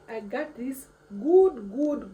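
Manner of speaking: good good drawn out loud and slow; proud boast. Acted. Bragging to viewers about motherland genes, loud and slow. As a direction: stretch every good with pride.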